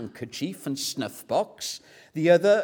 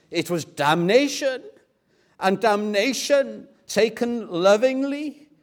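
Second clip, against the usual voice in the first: loud, raised, ringing declaration; impassioned pleading; 6 semitones higher, 5 dB louder than usual. Acted. Professor pleading with his Hebrew class, loud and fervent. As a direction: proclaim the answer with urgent fervour. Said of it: "pleads with them".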